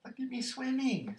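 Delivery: very high, loud little-one squeal; excited high-pitched child's cry; squeaky Roo. A narrator's reading. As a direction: squeak it out proudly. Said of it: "squeaked Roo".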